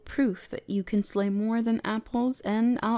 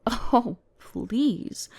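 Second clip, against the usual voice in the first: ordinary talk, nothing marked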